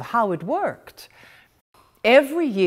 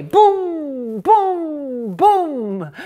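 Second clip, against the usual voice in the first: higher, loud booming chimes; clock chimes boom; 8 semitones higher, 5 dB louder, much slower than usual. Retold or imitated.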